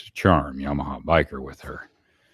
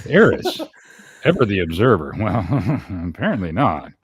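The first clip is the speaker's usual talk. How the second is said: usual speaking voice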